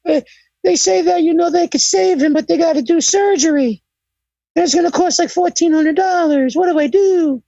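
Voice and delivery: high, pleading, panicked voice; Jim Cornette impression. Storyteller voices the manager panicking about his sick rat in a high plea. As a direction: beg Bruce for advice about the rat. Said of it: "he's like".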